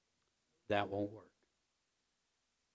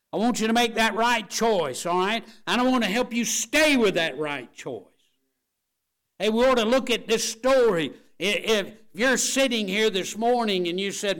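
loud, raised preaching voice; fervent exhortation; 10 semitones higher, 11 dB louder than usual. Real, not acted. Preacher pleading for the right choice, loud and fervent. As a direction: urge them at full force.

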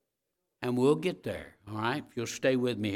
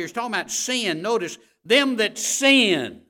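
loud, high, driving preacher voice; full-force preaching; 8 semitones higher, 10 dB louder than usual. Acted. Pastor preaching loudly to the church about sin. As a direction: preach it hard to the congregation.

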